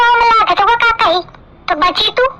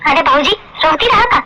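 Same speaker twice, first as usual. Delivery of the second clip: ordinary talk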